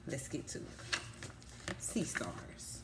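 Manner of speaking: put-on whispered, drawing the kids in before reading; hushed whisper. Teacher to kids, opening the book, in a whisper. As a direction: breathe it like a secret to start the story.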